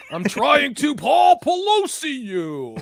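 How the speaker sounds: loud, slow, high mock threat; hammed-up shouted meme line; shouted joke line. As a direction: belt the punchline out slowly.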